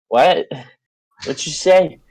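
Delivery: loud startled what; surprised. Retold or imitated. Startled reply to drunk stranger.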